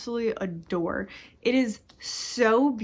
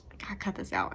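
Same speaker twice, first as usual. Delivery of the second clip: much softer, muttering to herself; muttered self-scolding; 8 dB quieter, faster than usual. Retold or imitated. Telling herself to stop crying, muttered softly. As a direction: scold yourself under your breath. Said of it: "And then it's like".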